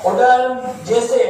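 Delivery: ordinary talk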